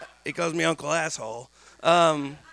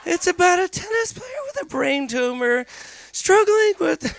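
put-on teen nephew voice; 10 semitones higher than usual. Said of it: "He goes".

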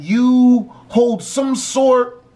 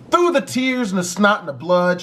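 usual speaking voice

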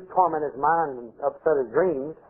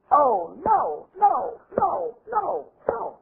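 very high repeated wails, fading away; hammed-up falsetto dismay; mock horror; 12 semitones higher, much slower than usual. Grandparents learn of the pregnancy, mock horror. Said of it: "When their parents find out".

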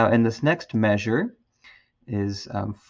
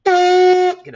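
much higher, slow, buzzing the words; fast buzz; 9 dB louder than usual. Shown as a demonstration. Teacher shows students a fast lip buzz, high and buzzing. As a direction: buzz it through tight lips. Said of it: "just speed up your lips".

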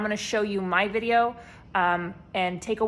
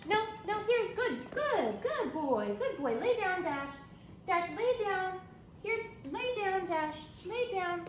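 much higher, softer nonstop puppy coaxing; calling a lost pet; 10 semitones higher, 7 dB quieter, slower than usual. Shown as a demonstration. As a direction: coo and fuss at a puppy. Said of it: "over communicating with my words and my voice".

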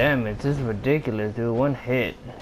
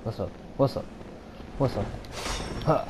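normal talking voice

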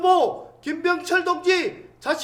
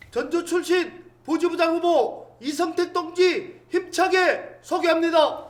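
usual speaking voice

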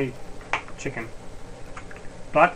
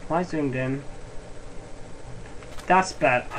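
ordinary talk, nothing marked

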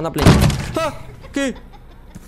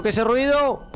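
spooked; 8 semitones higher than usual. Acted. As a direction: pitch jumps high in mock fright. Repeats it, spooked and higher.

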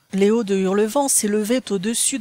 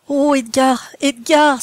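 raised, louder excited exclamation; overexcited, higher-pitched outburst; breathless cry; 4 semitones higher, 5 dB louder, slower than usual. A narrator's reading. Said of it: "s'écria-t-elle haletante".

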